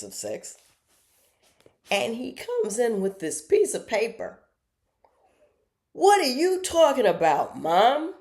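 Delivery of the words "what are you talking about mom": loud, high indignant challenge; mock indignant son. Son confronting his mom over the paper, indignant and loud. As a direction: act out an outraged son, voice way up. Retold quarrel with her son.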